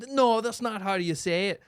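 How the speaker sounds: imitated correction. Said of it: "says something in their native".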